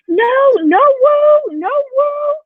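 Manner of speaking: put-on very high, loud toddler protest; high whiny "no woo"; mad toddler. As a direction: imitate a furious toddler refusing. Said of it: "he was yelling and crying".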